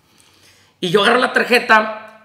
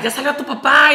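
higher-pitched mock yell; mild reenacted shout, voice lifted; retold joyful yell; 6 semitones higher, 3 dB louder than usual. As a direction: imitate calling the news out to the children. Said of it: "empezó a gritar".

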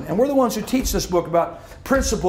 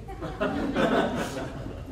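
breathy laugh; 8 dB quieter than usual. Real, not acted. Laughs at own family story.

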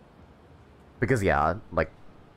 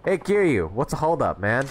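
shouting across the street, higher; calling out; 5 semitones higher than usual. Acted.

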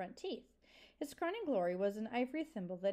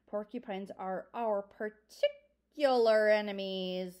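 slow, louder, pressing each word; insistent; 8 dB louder, slower than usual. A narrator's reading. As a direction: drive the warning home to the children. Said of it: "Lungwort insisted".

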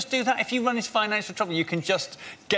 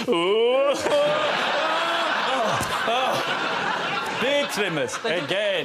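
mock gift opening; 6 semitones higher, much slower than usual. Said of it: "Every time they're opening a package".

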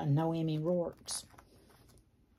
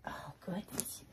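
whispering; 13 dB quieter than usual. Real, not acted. Whispered aside.

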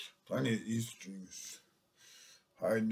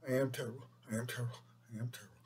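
each repeat softer until almost gone; fading repetition; 2 semitones lower, 8 dB quieter, much faster than usual. Real, not acted. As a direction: let it trail off into nothing.

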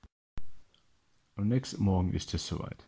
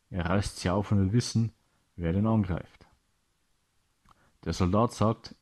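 plain reading voice

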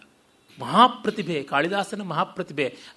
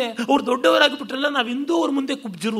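higher-pitched voicing of envious grumbling; mock envy; 6 semitones higher than usual. Lecturer voicing those who resent great predecessors, mock envy. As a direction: lightly act out a jealous complaint, pitched up.

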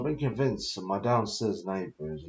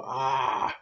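drawn-out "aah" cry, pitched up; imitated voice's cry; 6 semitones higher than usual. Man recounting a voice he heard, crying "aah". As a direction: mimic a wordless voice crying out. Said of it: "I heard this voice go".

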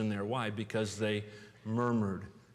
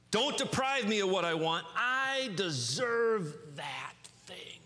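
entitled whine; 6 semitones higher, slower than usual. Acted. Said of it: "All these are the cry of self".

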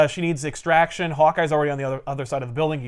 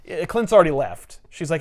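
ordinary talk

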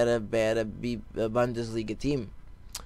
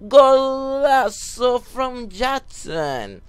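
long, stretched, much higher and louder Golazo; commentator goal call; 11 semitones higher, 8 dB louder, much slower than usual. Pretend. Gamer hyping his own goal like a commentator, stretched shout. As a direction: roar it like a TV commentator drawing out the goal.

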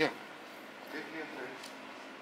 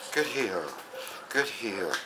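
soft praise to a dog; quietly reward the heel; calm praise; 10 dB quieter, slower than usual. Real, not acted. Trainer praising heeling dog, soft and calm.

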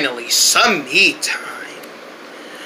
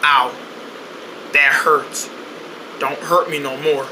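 hurt whimper; 7 semitones lower, 6 dB quieter than usual. Acted. Hurt, pleading.

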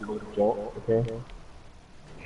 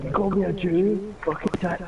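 mock outrage; 7 semitones higher than usual. Voicing an offended friend again.

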